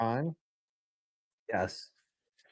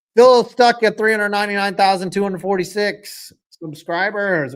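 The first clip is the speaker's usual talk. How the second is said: ordinary talk, nothing marked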